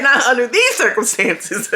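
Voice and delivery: ordinary talk, nothing marked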